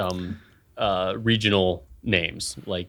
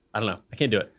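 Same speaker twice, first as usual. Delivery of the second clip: ordinary talk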